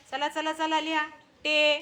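ordinary talk

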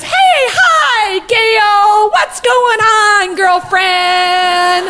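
high, slow, drawn-out hailing; hammed-up oblivious friendly introduction; gushing greeting. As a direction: stretch every name out high, like hailing a girlfriend. Retold friend loudly greets Gayle, introduces.

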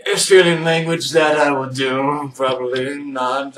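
slow, sing-song put-on voice; mock Australian. Vlogger joking about Australian, slow sing-song voice. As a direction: drawl it out in a jokey lilt.

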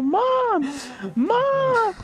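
put-on a small kid yelling for his mother; shrill repeated calls of Mom; kid calling mom. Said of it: "He's like".

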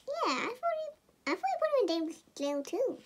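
high squeaky toy voice; lightly voiced toy. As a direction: voice the little toy agreeing. Toy Cookie answers in a voice.

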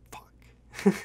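恐怖のささやき; whispered curse. Retold or imitated. Caught by cops, quiet dread.

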